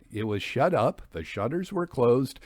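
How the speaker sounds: ordinary delivery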